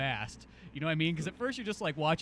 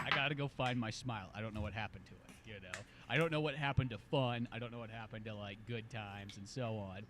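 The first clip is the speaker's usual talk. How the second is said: mopey lament; 6 semitones lower, 10 dB quieter than usual. Retold or imitated. Voicing the lost midlife character searching for his smile, soft and mopey. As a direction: sink into midlife gloom.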